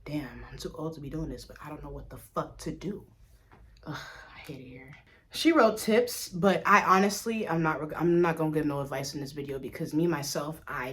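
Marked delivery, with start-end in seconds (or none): 0.0-4.9 hushed, breathy-free whisper of regret; whispered inner thought. Retold or imitated. Grown-up admitting inner thoughts to viewers, in a whisper. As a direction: whisper it like a private realization. Adult realizing trauma, inner voice.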